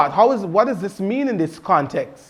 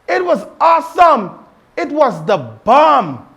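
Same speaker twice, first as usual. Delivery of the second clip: excited informal; 6 semitones higher, 5 dB louder than usual. Shown as a demonstration. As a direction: gush about the conference like a fan. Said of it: "how this would be expressed in the informal".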